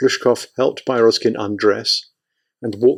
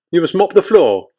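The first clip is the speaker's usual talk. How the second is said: feverish order; 7 semitones higher, 4 dB louder than usual. Acted. Feverish commander gives order.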